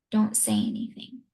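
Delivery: low, near-whispered retold warning; hushed warning. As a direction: drop low and hush a hidden child. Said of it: "I want you to be very quiet".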